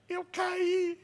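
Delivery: put-on high little boy voice; whiny child. Son answering mom, high and childish. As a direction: whimper like a small kid. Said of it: "o menino responde pra mamãe".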